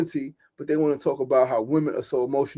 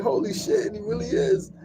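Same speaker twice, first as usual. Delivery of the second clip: stunned disbelief; 3 dB quieter than usual. Retold or imitated. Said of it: "I was like".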